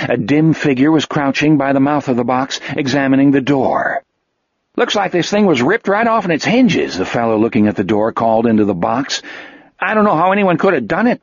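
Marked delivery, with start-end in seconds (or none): 4.8-7.0 higher cop voice, puzzled; puzzled cop looking at hinges. A narrator's reading. Cop examining door voiced. 9.8-11.2 higher cop voice, puzzled; puzzled cop looking at hinges. A narrator's reading.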